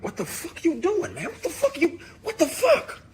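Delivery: mock high-voiced spaz-out; spazzing out. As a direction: retell a freak-out in a squealed, escalating yell. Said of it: "you spaz even more".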